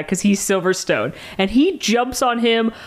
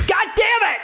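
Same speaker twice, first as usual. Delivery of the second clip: high-pitched mock-furious cry, laughter around it; exasperated outburst; 11 semitones higher than usual. Host retelling a failed introduction trick, comically exasperated. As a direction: blow up in comic frustration.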